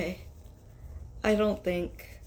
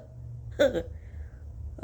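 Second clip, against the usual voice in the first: breathy laugh; 7 semitones higher than usual. Real, not acted. Laughs after sneezing.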